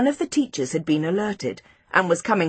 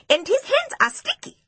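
high-pitched insistent accusation; eager tattling to teacher; blurted out; 11 semitones higher than usual. A narrator's reading. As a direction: blurt out evidence in a high child's voice. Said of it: "Seppo blurted out".

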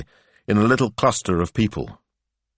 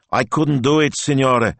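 mildly miserable, higher plea; a touch of misery; 8 semitones higher, 4 dB louder than usual. A narrator's reading. Ivano confessing failure to Speranza, miserable. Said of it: "said Ivano Maestro miserably".

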